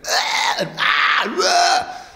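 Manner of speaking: hammed-up crowd screams in a riot; imitated screaming. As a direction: cry out like a gassed protester. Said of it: "you can hear people screaming".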